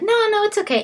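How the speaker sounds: squeaky high, brushing it off; high polite refusal. Retold or imitated. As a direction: play it light and high, no fuss. Secretary politely declining offer.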